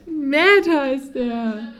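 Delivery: usual speaking voice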